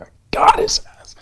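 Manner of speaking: high, excited whispered boast; gleeful whisper. Retold or imitated. Backstage before results, gleeful whisper. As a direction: gloat under your breath. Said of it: "I'm like".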